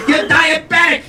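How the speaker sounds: high-pitched scolding shout; hammed-up old wife's shriek; nagging elderly wife. Acted wife scolding her husband.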